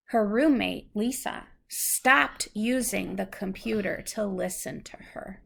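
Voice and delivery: clear, measured storybook reading; reading to a child. Acted. Parent reads a picture book to a child, gentle storybook reading.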